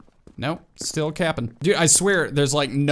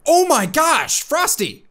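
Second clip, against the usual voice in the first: high-pitched cry of disbelief; real shocked outburst; astonished shout; 11 semitones higher, 6 dB louder, slower than usual. Real, not acted. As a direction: exclaim at the rival's move. Beaten by rival player.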